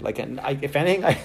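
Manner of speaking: ordinary talk, nothing marked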